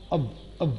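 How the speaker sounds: اب as a short "uhv" with v; quick unstressed "of", said as اب with a v ending; short form of "of". Shown as a demonstration. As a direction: model اب as weak schwa plus v. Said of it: "آگے اس کے وی sound آ جائے گا".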